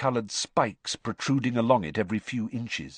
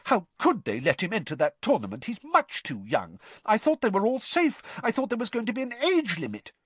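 high, fretting mum voice, faster at the end; mother's anxious voice; 12 semitones higher than usual. Retold or imitated. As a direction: son mimicking his worried mother. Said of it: "Charlie imitated his mother's anxious voice".

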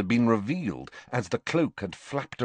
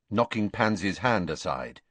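ordinary delivery

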